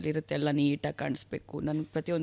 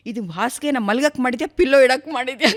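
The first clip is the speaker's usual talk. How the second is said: husband's grumble imitated loud and higher; playful retold exasperation, raised voice; mock complaint; 6 semitones higher, 11 dB louder than usual. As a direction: protest loudly in jest, building into a laugh. Voices husband's complaint about bed.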